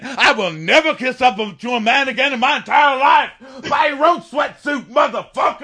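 high, loud shouted tirade; mock furious rant. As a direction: explode with fed-up defiance. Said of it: "that was finally a feminist rant".

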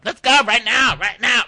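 high, very loud challenge; hammed-up yell, spoiling for a fight; imitating a bro shouting someone out; mock fight shout. Acted quarrel escalating.

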